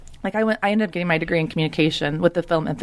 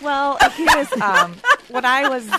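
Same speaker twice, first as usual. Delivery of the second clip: crunching ice; 6 semitones higher, 4 dB louder, slower than usual. Real, not acted. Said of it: "it's my iced tea".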